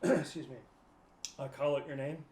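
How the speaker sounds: normal talking voice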